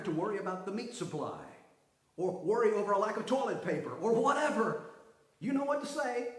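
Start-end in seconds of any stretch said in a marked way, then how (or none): none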